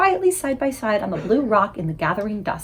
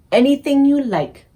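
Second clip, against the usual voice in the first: proudly; 5 semitones higher, 4 dB louder than usual. Acted. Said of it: "the B F G said proudly".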